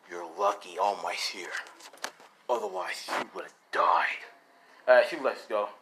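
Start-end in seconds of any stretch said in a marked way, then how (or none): 0.1-4.2 lower, whispered threat; menacing threat. Acted. Deku warning the villain in a low whisper.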